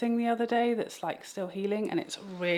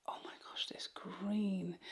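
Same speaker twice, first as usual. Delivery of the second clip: hushed, breathless whisper; awed whisper; 12 dB quieter than usual. Real, not acted. Vlogger awed by the green shadow, whispering. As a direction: whisper it like you can't believe your eyes.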